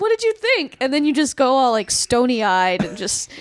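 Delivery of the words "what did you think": sweet eager asking. Retold or imitated. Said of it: "be like".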